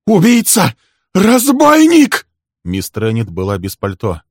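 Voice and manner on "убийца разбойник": very high, loud shriek of accusation; hysterical scream. A narrator's reading. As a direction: scream the accusation, stretching the last word. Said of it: "она закричала".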